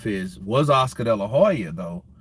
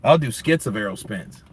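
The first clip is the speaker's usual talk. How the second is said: normal talking voice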